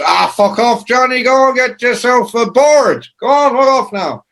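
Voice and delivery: high, loud mock dismissal. [name] telling [name] to go get a board, loud and high. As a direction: shoo him off in a put-on voice. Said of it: "And [name] goes".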